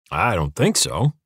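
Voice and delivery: slow, unhurried pushback; stubborn refusal. A narrator's reading. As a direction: refuse at your leisure, dragging it out.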